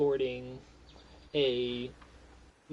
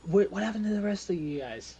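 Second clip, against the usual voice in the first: higher in-character question; Molly; 6 semitones higher, 3 dB louder, faster than usual. Acted. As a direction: pitch it up as a game character. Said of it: "Molly is just like".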